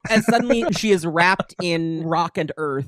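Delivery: usual speaking voice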